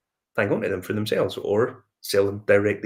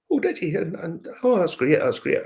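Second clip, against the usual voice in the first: higher-pitched imitation of an enthusiastic reply; retold pleased surprise, pitched up; delighted reaction; 8 semitones higher than usual. Promoter hears he bought a bottle, imitated delight.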